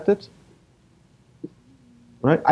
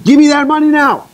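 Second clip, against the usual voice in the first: much higher, louder gunman's threat; mock robber demand; 12 semitones higher, 5 dB louder than usual. Preacher acting the gunman's demand, hammed-up threat. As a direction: bark it like a robber. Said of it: "He said".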